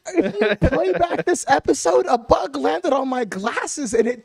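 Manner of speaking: high, loud, laughing exasperation; worked-up rant. Real, not acted. Co-host recalling a bug on his glasses, worked up. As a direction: rant in high-pitched comic disbelief.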